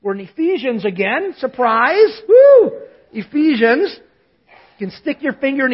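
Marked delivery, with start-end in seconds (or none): 1.4-4.0 a loud, much higher whoop of mock excitement; mock surprise whoop. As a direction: cheer the news like a game-show reveal. Pastor teasing about returning to Ephesians.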